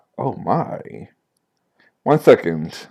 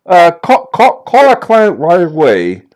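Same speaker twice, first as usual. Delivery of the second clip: higher, louder urgent order; detective bursting out on the phone to call the client; excited detective; 6 semitones higher, 5 dB louder than usual. Acted. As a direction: rush the order, thrilled. Excited detective over the artifact.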